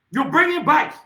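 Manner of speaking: angry shout. Real, not acted. Accusing the president at a rally.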